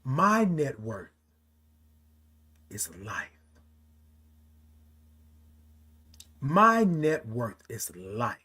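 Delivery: slow, low and soft; deliberately understated. Real, not acted. Speaker telling live viewers what truly counts, understated. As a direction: let each word land slowly, dropping away low. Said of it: "I'm saying it like that".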